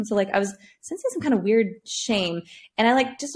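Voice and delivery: ordinary talk, nothing marked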